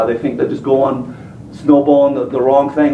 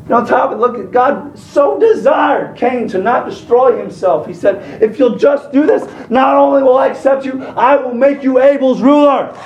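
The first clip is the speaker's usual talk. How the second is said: preaching at full force; 10 semitones higher than usual. Acted. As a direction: plead with the congregation, rising higher.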